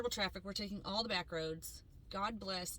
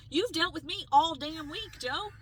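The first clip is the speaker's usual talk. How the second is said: higher, louder, slow indignant snap; retold angry retort; 6 semitones higher, 8 dB louder, slower than usual. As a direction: throw it back at him, outraged. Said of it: "Um, I was so mad".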